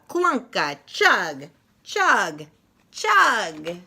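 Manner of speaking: lively picture-book read-aloud with punchy sound words; reading to children. Acted. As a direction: read it like story time for little kids, full of bounce.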